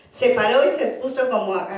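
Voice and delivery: ordinary talk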